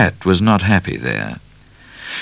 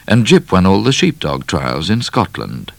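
usual speaking voice

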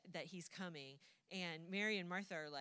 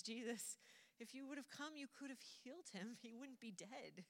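high, hushed, acted plea; soft grieving reproach; 5 semitones higher, 5 dB quieter than usual. Mary tells Jesus he could have healed her brother, soft reproach. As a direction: imitate a hurt sister, softly and higher, slowing at the end.

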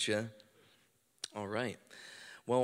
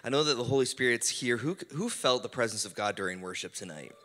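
normal talking voice

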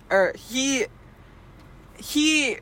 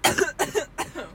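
sudden coughing fit; 6 semitones higher than usual. Real, not acted. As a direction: cough like water went down wrong. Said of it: "Choking on my water".

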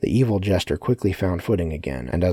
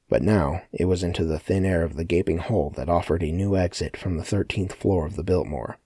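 ordinary delivery, nothing marked

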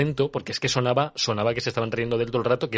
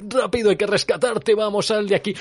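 high, hurried mock rescue call; mock urgent rescuers; 10 semitones higher, 3 dB louder than usual. Knights rescuing a man from the convent, urgent high call. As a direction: play the knights bursting in to save him.

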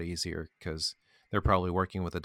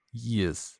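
ordinary talk

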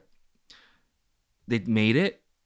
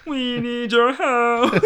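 slow, much higher and louder alien plea; 14 semitones higher, 8 dB louder, much slower than usual. Shown as a demonstration. Showing off his alien voice to a friend, slow high alien plea. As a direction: stretch it out like a pleading movie alien. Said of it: "I can do the alien accents".

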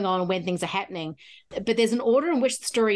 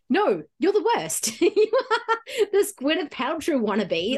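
mock scolding; 6 semitones higher, slower than usual. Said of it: "I'm like".

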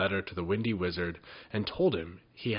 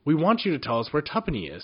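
higher, louder, fast and firm; 6 semitones higher, 5 dB louder, faster than usual. A narrator's reading. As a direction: insist without budging. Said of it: "said Jinx firmly".

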